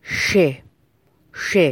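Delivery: ش as an overdone hissing sh; mock over-pronounced ش; exaggerated six-dot shin. Shown as a demonstration. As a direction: overdo the sh of ش, each one on its own. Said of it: "یعنی به این شکل".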